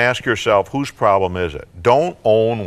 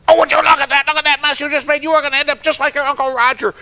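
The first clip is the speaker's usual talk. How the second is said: much higher, shrill-pitched nagging, fast; scolding parent; 3 dB louder, faster than usual. Acted. Parent nagging son at breakfast over spilled milk, high and scolding. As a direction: nag the kid over the mess.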